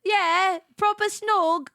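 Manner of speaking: a hint of a British accent; mock British accent. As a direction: say it like a Love Island lad. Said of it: "He's like".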